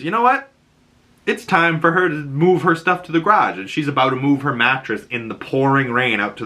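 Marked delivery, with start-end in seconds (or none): 0.0-3.6 high mock defiant decision; stubborn teen. Ellie leaving in the rain, high defiant announcement.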